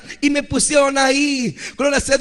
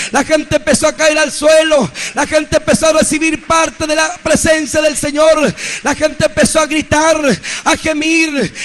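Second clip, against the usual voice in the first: full-force preaching; 2 semitones higher, 4 dB louder than usual. Acted. Preacher telling the church, shouted preaching.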